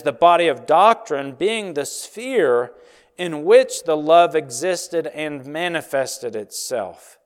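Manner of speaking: normal talking voice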